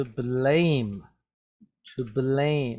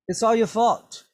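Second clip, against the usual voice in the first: raised, higher-pitched accusation; mild acted yell; 7 semitones higher, 4 dB louder than usual. Eugene blaming someone as they are lost, mild yell. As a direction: throw the blame with a lightly raised voice. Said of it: "Eugene yelled".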